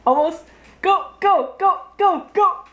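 high, rapid go go; excited cheering. Real, not acted. Cheering child at straw.

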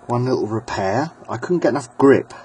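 ordinary talk

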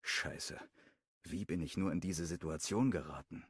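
ordinary delivery, nothing marked

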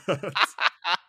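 high, loud burst of laughter; amused laugh. Real, not acted. Co-host laughing at a friend's kind reading, amused.

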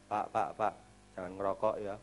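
low, soft, hesitant nudge; timid rebuke. Retold or imitated. Churchgoer timidly tells a man not to smoke, low and soft. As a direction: tiptoe into a warning.

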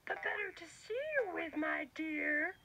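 high sly granny voice; wolf in disguise answering, high and sly; wolf as grandma. Acted.

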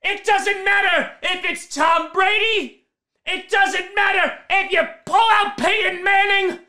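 high-pitched, drawn-out big announcer intro; rousing pregame hype call. Acted. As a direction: build the hype, stretching the key words.

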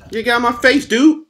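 excited. Real, not acted. Reacts to a character's face.

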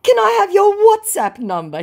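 very high, loud mock request; hammed-up hopeful wheedle; wheedling scammer. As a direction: ask in a squeaky put-on voice. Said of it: "he tries".

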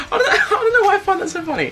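giggling through fast, high words; can't get it out for laughing. Real, not acted. Youtuber cracking up at hockey on money, laughing. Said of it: "I could not stop laughing".

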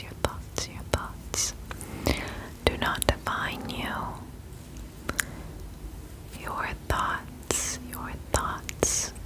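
ordinary talk

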